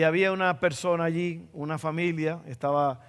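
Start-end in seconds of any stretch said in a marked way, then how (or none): none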